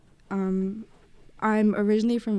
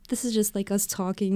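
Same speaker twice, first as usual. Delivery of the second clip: normal talking voice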